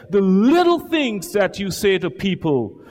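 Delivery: building from steady to a loud, high shout; preaching crescendo. Real, not acted. Preacher to congregation, rising to a shout.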